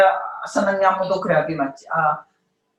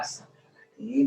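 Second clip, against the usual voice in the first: istighfar demo; 7 semitones higher, 4 dB quieter, faster than usual. Shown as a demonstration. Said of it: "baca istighfar".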